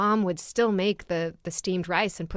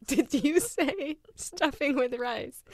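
mock shocked question; 8 semitones higher, slower than usual. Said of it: "The look on your face just froze".

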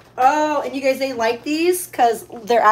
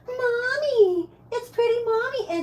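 crying pet voice; 10 semitones higher, 5 dB quieter than usual. Pretend. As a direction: whine it like a cat begging its owner. Voicing the pet greeting owner.

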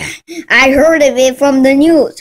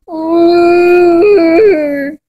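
ordinary talk, nothing marked

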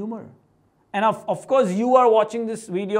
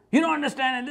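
retold mock yell; 5 semitones higher than usual. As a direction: imitate someone yelling, without real volume. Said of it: "and he's yelling".

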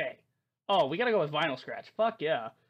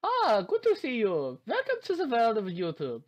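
put-on German accent; 6 semitones higher, 4 dB louder than usual. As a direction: greet them in a thick German accent. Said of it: "I may just do a German accent".

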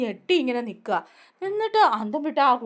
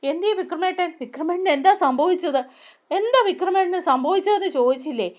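anxious asking; 6 semitones higher than usual. Retold or imitated. Radha rushes in, panicked.

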